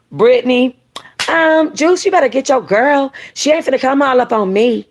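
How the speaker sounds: a hint of a sassy woman. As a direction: tell him to get his girl, with attitude. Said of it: "what about Persia".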